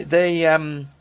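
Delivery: normal talking voice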